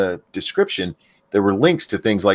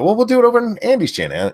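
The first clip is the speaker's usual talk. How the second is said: mock quote; 14 semitones higher, 4 dB louder, faster than usual. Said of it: "they're like".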